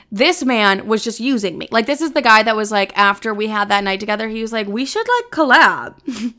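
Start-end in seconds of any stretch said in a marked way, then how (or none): 4.7-6.3 much higher, sing-song fake-friendly pitch; mocking the guy. Mocking the man who wanted a social media collab, sing-song. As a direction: mimic a smarmy user angling for exposure.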